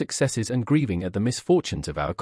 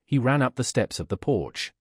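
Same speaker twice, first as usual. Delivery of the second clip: plain reading voice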